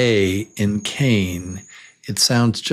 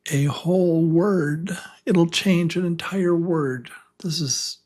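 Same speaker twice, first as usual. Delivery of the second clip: higher, hushed whisper of a secret; suspenseful teacher whisper; dramatic whisper; 6 semitones higher than usual. Shown as a demonstration. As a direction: whisper it like a big reveal. Teacher builds suspense about letter.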